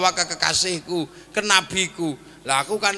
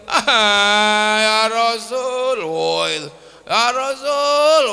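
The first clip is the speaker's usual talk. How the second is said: slow, loud tearful cry of recognition; 4 dB louder, much slower than usual. Acted. Bedouin realizing he threw the Prophet, slow tearful cry. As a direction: drag out the words, overcome with emotion. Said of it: "orang ini nangis".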